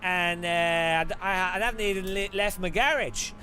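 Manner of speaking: high, slow, hammed-up Aussie drawl; mock Aussie bloke bragging about distances; Australian accent. Mocking Australians on stream in a bad Aussie accent. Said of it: "An Australian would just say to you".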